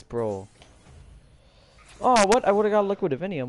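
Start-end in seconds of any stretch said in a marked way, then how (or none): 2.0-3.5 high, loud, quick disbelief; surprised outburst. Real, not acted. Player seeing missed Liquid Divinium, surprised outburst. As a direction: burst out high, then rush on. Surprised by what was won.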